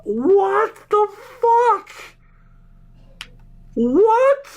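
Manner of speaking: very high, cracking into laughter; incredulous squeal. Real, not acted. Streamer asking if they are kidding, high incredulous squeal. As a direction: squeal it in utter disbelief. Shocked reaction to the draft pick.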